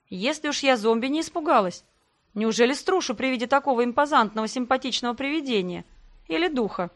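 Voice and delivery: ordinary delivery, nothing marked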